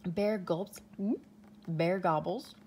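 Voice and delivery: usual speaking voice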